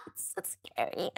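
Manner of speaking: breathy whispered mock fright; a pretend-scared whisper about her own habit.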